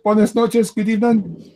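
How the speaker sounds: high, showy Spanish "Buenas noches"; proud tourist greeting in Spanish; mock Spanish greeting. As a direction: show off your Spanish with a grin. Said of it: "See, I can speak Spanish".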